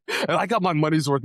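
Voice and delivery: ordinary delivery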